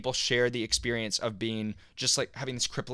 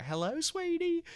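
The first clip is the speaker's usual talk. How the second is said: much higher, slow, sing-song hello from mom; sweet mom voice; 4 dB quieter, slower than usual. Retold or imitated. Mom picks up the phone to her son, high and sweet. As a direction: chirp it up high like a cheerful mother.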